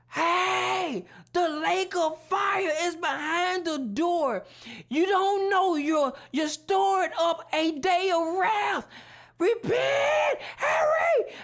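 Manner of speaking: desperate warning shout. Retold or imitated. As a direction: scream the warning at them. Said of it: "I screamed with everything in me".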